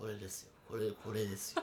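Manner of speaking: forced low, soft voice; extremely deep bass voice. Shown as a demonstration. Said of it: "高いと思うよ".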